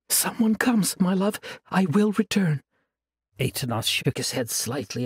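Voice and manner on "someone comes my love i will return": sweet, higher and innocent; angel voice. Acted. Sorceress faking an angel's voice to lure her captive, sweetly. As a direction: coax him like a gentle angel. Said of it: "voice of an angel".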